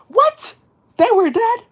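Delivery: very high, loud mock shock; shocked outburst. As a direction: shriek it in hammed-up disbelief. Retells shocked reply to host.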